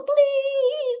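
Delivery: very high, sustained mock twinkle; high sparkle sound. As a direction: sing out a high, drawn-out sparkle. Mimics a dimpled entrance sparkle.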